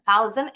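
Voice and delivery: THOUSAND with the s voiced as z; s seperti z. Shown as a demonstration. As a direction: say THOUSAND as "thau-zen". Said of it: "S-nya bunyinya seperti Z". (THOUSAND dibaca dengan bunyi z)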